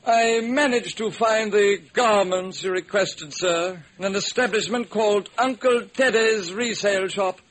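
prim servant reporting to master; stiff butler voice. Acted.

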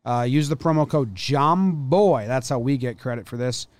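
energetic sponsor read; upbeat ad read. Acted. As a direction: pitch the promo like a host read.

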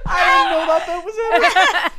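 shrieking with laughter, high and loud; screaming laugh, embarrassed; laughing hysterically. Real, not acted.

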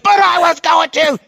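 put-on high yelled kid voice; hammed-up kid shout; mocking child whine. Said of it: "And I said".

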